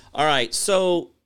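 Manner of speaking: usual speaking voice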